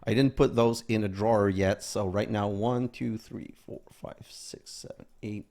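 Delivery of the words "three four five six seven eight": whispered counting; counting under breath. Real, not acted.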